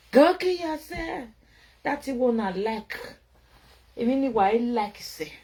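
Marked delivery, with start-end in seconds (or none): 0.1-1.3 mock insult. Mocking harsh critic.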